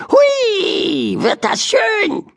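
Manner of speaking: very high, fast squeaky bird voice; high squeaky magpie rejoicing; squealing magpie. Acted. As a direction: squeal it like an excited little magpie. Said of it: "jauchzte sie".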